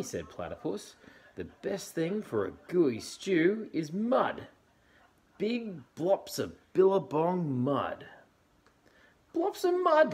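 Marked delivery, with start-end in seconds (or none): 1.4-8.1 high, loud, slow advice; Platypus voice. Acted. 9.3-10.1 very high, loud laughing question; laughing Dingo. Acted. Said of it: "laughed Dingo".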